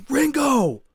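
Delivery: high, loud retold call; yelling a name. Calling to his friend down the hole, yelling. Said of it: "'cause we're yelling".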